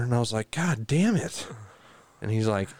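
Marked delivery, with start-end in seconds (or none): 0.5-1.5 higher-pitched retold groan of dismay; exasperated. Owner reacting to the vet's bad news, exasperated. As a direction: curse your rotten luck.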